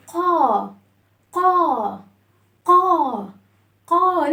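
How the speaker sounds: "قا" held as a long open aa; drawn-out "قا", the vowel fully open; open fatha sound. Shown as a demonstration. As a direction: open the mouth wide on "قا". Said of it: "هنفتح الصوت".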